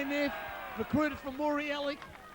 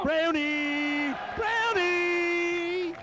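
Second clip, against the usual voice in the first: loud, higher fan call; cheering shout; 5 semitones higher, 4 dB louder, much slower than usual. Real, not acted. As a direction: bellow the nickname from the crowd. Calling out to a player.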